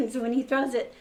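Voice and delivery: ordinary delivery, nothing marked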